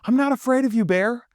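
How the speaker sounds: high, loud mock-defiant little voice; playful imitation of a petite wife squaring up; wife's brave stand-off. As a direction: puff up tiny and fierce against a bear. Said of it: "stretches both arms out super wide and goes".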